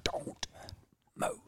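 breathy, urgent hushed command; whisper yell. Shown as a demonstration. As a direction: force it out in a half-whisper. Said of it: "was it whisper yell".

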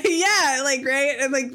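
laughing high and loud; breaking into laughter mid-word. Real, not acted. Laughing after friend's joke.

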